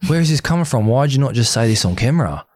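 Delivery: high-pitched retold protest; indignant. Confronting partner in the taxi, retold indignation. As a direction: challenge her in mock-argument indignation.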